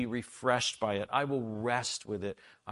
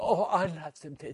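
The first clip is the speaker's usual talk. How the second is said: mock genteel tea guest; 6 semitones higher, 4 dB louder than usual. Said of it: "I said".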